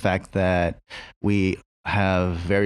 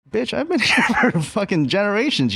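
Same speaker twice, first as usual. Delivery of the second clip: much higher, exasperated comeback with a laugh in it; mock indignant retort; 12 semitones higher, 3 dB louder than usual. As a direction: snap back, half laughing, half fed up. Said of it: "And it's like".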